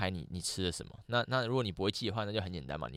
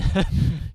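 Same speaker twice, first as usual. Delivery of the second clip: laugh; 9 semitones higher, 10 dB louder than usual. Real, not acted. Laughs at own remark.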